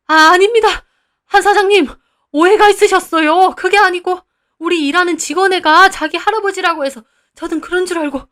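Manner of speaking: very high, loud, frantic; panicked excuses. Acted. Owner frantically explaining himself to the building owner, high and loud. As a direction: beg and backpedal fast.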